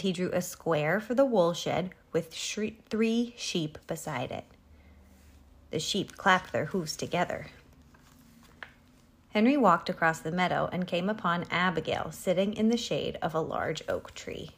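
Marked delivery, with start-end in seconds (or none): none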